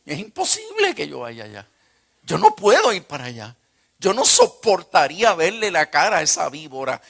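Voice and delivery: loud, high-pitched vehement protest; indignant refusal. Retold or imitated. Son refusing to face the woman who broke his home, indignant. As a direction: refuse point-blank, building to a loud outburst.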